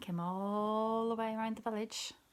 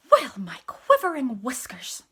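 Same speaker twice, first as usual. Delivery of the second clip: indignant cat; 4 semitones higher, 12 dB louder than usual. A narrator's reading. Indignant cat's catchphrase.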